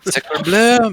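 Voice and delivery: put-on French accent. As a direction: toss off a mock French exclamation. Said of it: "my French accent".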